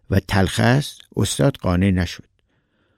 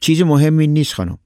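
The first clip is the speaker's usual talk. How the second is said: higher, raised-voice reassurance; calling back; 6 semitones higher, 4 dB louder than usual. A narrator's reading. Husband answers wife up at the window, calling back. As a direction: call back loudly that all is fine.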